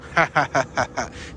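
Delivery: low, staccato chuckle; laugh. Real, not acted. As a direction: chuckle low in short bursts. Amused by the ducks.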